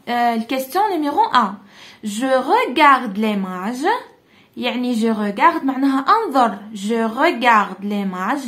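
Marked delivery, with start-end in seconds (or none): 2.0-3.9 slow, stretched "Je regarde l'image." with the voice rising at the end; slow model reading. Shown as a demonstration. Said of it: "teacher models French phrase". 6.7-8.5 "Je regarde l'image." again slow and stretched, a bit lower; calm, slow repeat of "Je regarde l'image."; slow model reading. Shown as a demonstration. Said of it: "teacher models French phrase".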